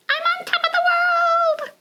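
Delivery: high thrilled exclamation; triumphant penguin. Acted. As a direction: crow in triumph. Penguin reaches North Pole.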